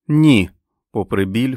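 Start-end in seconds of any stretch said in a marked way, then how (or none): 0.1-0.5 loud, high refusal; outburst. A narrator's reading. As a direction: burst out in protest. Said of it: "Френ зірвалася на ноги". (гучний, обурений протест)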